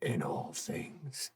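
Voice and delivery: low, hushed and ominous; secretive creature voice. Acted. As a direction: speak as an ancient keeper of secrets.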